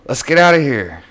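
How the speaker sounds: high, loud panicked cry; acted panic. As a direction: call out in a panic to flee. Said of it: "The trio starts to panic".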